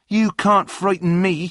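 high, loud defiant retort; indignant challenge. A narrator's reading. John defies a threat.

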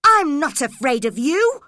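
put-on girl defying the man who locked her in, shouting; defiant angry cry. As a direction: fling it at him, refusing to be scared. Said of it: "cried Cathy angrily".

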